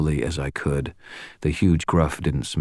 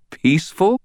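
high, incredulous question, louder; barely contained laugh; 6 dB louder than usual. A narrator's reading. Wizard asked to die peacefully, barely containing a laugh. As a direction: squeak it out in amused disbelief. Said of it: "barely containing a laugh".

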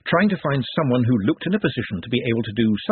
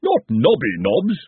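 exclamation; 3 semitones higher, 4 dB louder, slower than usual. Acted. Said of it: "exclaimed the former dean".

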